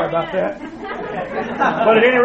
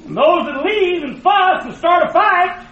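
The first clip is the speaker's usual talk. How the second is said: preaching shout; 8 semitones higher, slower than usual. Real, not acted. Preaching at full force.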